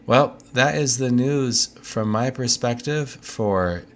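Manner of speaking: ordinary delivery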